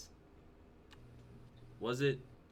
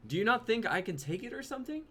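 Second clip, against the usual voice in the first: ordinary talk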